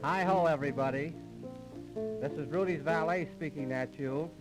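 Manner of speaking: ordinary talk, nothing marked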